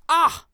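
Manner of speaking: sudden piercing high shriek; shrill triumphant shriek. A narrator's reading. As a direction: let out a wild shriek. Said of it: "shrieked Harbauer".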